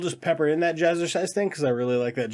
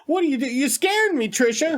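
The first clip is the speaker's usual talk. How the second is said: hammed-up Boston Wahlberg; Mark Wahlberg impression; 11 semitones higher than usual. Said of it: "'cause he'd be like".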